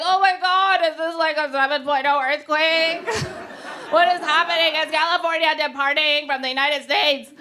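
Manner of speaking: startled awake in alarm; mock panic over a shaking bed; panicked wake-up. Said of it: "I'm like".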